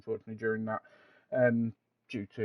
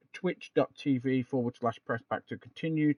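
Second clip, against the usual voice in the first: ordinary delivery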